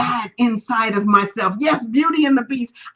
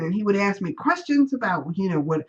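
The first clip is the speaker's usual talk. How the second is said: normal talking voice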